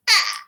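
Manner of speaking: high sharp screech; pterodactyl shriek. Real, not acted. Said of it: "I can do the pterodactyl screech".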